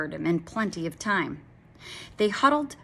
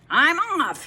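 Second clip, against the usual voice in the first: loud, higher parting shout; yelled exit; 9 semitones higher, 6 dB louder than usual. A narrator's reading. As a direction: shout it on the way out the door. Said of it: "he yelled".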